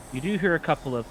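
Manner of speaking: ordinary delivery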